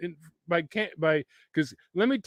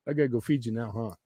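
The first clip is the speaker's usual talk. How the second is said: ordinary delivery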